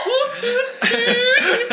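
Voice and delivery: high whooping robot sound; robot dance noise. Retold or imitated. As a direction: imitate a dancing robot.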